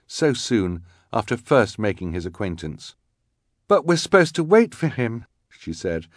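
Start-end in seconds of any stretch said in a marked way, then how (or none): 3.7-5.2 upset. A narrator's reading. Violet upset.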